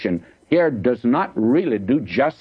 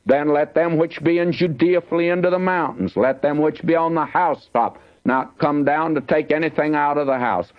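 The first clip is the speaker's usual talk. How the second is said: raised, measured scripture reading voice; 4 semitones higher than usual. Acted. Preacher reading a warning verse to the congregation, raised and solemn. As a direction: lift the verse above the sermon.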